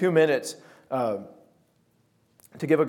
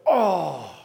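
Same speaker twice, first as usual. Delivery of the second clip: dawning oh. Retold or imitated. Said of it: "And I just go".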